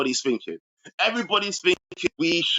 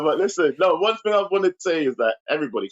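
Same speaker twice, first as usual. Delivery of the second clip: usual speaking voice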